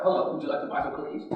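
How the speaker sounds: quick, low, breathless mumble of the sales line; bored Girl Scout. Retold or imitated. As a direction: rattle off the cookie pitch without caring. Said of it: "they don't even talk like that now".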